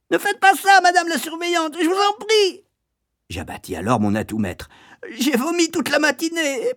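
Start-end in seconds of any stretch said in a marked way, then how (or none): 0.1-2.6 very high, squealing plea; frantic pleading. A narrator's reading. Boy begging the matron to stop pressing, pleading. 5.2-6.7 very high, tearful whine; snivelling. A narrator's reading. Boy playing his trump card to the matron, snivelling. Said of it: "pleurnichai-je".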